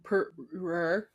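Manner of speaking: "Poorer" broken up with heavy, laboured R's; exaggerated American "Poorer", half laughing; struggled hard R. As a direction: chew through the R's of "Poorer". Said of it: "when she says poorer".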